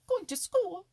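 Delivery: very high, loud, incredulous cry; mocking exclamation. A narrator's reading. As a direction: shoot up high and loud in ridicule. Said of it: "exclaimed Happy Jack".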